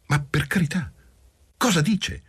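wife brushing off the accusation, a bit startled; a little surprised. A narrator's reading. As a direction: protest with mild surprise. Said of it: "Un po' sorpresa".

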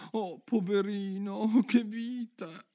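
high, slow, pitying Alice. Acted. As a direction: sympathize with the poor bird. Alice pities the pigeon. (Alice compatisce il piccione)

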